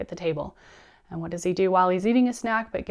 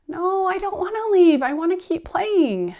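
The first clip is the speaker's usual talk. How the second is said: put-on whining child; 12 semitones higher, 6 dB louder than usual. Child protesting to parent about leaving the park, loud high whine. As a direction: imitate a small boy whining in protest.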